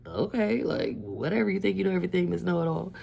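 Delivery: imitated whatever-you-say tone; dismissive. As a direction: humor Miss Know-It-All. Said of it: "the way that she said".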